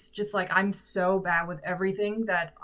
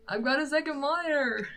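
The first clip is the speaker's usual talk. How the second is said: much higher, put-on repeat; playful high voice; 7 semitones higher than usual. Streamer jokily restating to viewers, playful high voice. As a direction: say it again in a playful raised voice.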